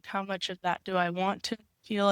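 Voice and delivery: ordinary delivery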